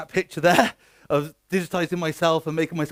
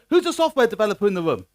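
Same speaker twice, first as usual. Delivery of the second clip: ordinary talk, nothing marked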